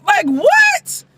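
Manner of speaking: very high squeal of disbelief; incredulous. Real, not acted. Viewer baffled by the wife's gushing, incredulous. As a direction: burst out high, utterly baffled. Incredulous after mimicking Chelsea.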